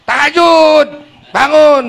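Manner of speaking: loud high scolding command; harsh wake-up shout. Retold or imitated. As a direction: bark her out of bed for prayer. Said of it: "ada suami bilang ke Mamah Nunung".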